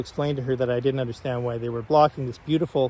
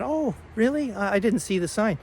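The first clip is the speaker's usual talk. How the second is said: mock-innocent surprise, pitched high and soft; innocently pretending not to know; playing dumb; 7 semitones higher, 3 dB quieter than usual. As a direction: act clueless, voice lifting in feigned surprise. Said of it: "I acted dumb".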